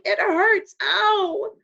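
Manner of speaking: high, loud yelp of pain; yelling in pain. Acted. Patrick yelling at Omri that it hurts. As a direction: cry out sharply, hurt. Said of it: "Patrick kept yelling".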